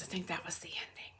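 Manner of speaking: hushed whisper, almost no voice; a breathy confiding whisper. Real, not acted. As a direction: let it slip out as a secret aside. Conspiratorial aside after joke.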